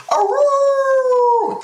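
high loud wolf howl. Retold or imitated. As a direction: throw your head back and howl. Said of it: "What does the Wolfman say".

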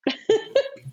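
short, high burst of laughter. Real, not acted. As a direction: let out a high giggle. Laughing before naming the book.

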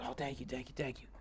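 quiet, relieved thanks to a stranger; grateful homeless man. Retold or imitated.

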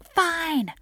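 put-on mother yelling at her kids about food, loud and fed up; exasperated yelling at kids. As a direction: blow up at the kids, throwing up your hands. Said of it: "I was yelling at them".

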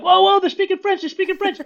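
high, excited whoa; hammed-up amazement at his kids; amazed. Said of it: "I'm like".

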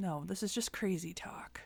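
sarcastic hushed whisper. Pretend. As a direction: whisper it like a cover-up. Mock conspiracy joke.

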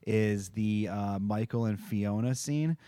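normal talking voice

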